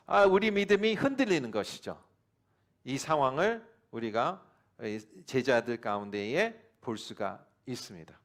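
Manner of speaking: ordinary talk, nothing marked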